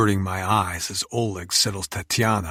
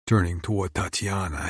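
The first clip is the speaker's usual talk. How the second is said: ordinary delivery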